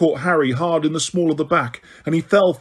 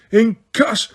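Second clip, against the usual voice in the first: roared spell; 7 semitones higher than usual. A narrator's reading. Harry roaring a spell at Snape, shouted curse. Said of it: "Harry roared".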